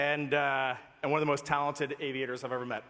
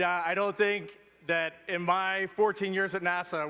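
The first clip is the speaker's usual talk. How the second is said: ordinary delivery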